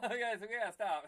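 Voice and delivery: laughing loudly through the words. Real, not acted. Host laughing at his own confession, loud laughing. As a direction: crack up while trying to end the show.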